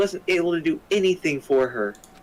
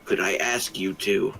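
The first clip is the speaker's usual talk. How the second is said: put-on deep voice for a girl character; low girl voice; 12 semitones lower, 3 dB quieter than usual. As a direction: speak her lines low and steady. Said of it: "Why are you talking like that?".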